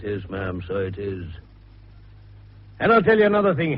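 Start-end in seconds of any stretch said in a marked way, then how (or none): 2.8-3.8 forceful. Acted. Fell insists forcefully on a point.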